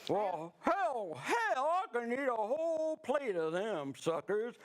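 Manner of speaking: hammed-up Southern boast; Southern accent. Camper outbragging friends on meatballs, Southern accent. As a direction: crow about it with a stretched Southern twang. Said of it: "[name]'s in southern Sweden".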